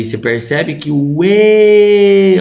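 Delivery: slow, drawn-out calling voice, still high and loud; stretched calling voice. Shown as a demonstration. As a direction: keep calling out, stretching each syllable. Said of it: "você dá uma esticada nele". (voz de chamar, lenta e esticada)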